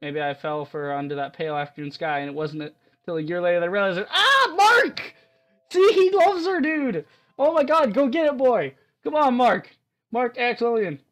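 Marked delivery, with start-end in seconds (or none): 4.1-11.0 high, loud excited cheering; fan squeal. Real, not acted. Streamer urging Mark to go get her, squealing. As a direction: cheer him on like a thrilled shipper.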